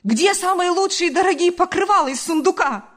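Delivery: sustained high, loud yell; loud, high outraged demand; angry mother's shout. A narrator's reading. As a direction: keep yelling the accusation at a high pitch. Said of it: "закричала мать".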